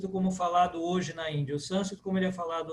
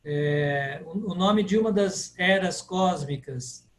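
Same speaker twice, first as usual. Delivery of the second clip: usual speaking voice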